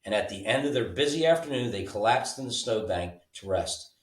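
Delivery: ordinary delivery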